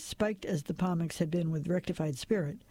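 ordinary delivery, nothing marked

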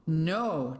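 usual speaking voice

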